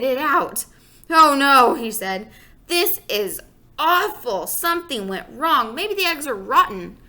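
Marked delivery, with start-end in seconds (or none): 1.1-1.7 loud high dismayed cry. Acted. Father spitting out his omelet, loud dismay. 2.7-7.0 disgusted. Acted. Father disgusted by omelet.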